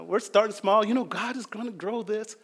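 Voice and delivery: ordinary talk, nothing marked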